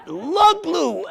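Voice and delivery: high, wondering crowd echo; mock crowd excitement. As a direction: imitate people thrilled by a treat. Crowd's delighted reaction, acted out.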